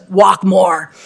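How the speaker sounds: terse mentor. Retold or imitated.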